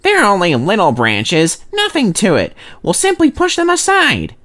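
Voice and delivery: high, proud boasting; boastful. A narrator's reading. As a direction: brag it off lightly. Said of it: "began to regret his boasting".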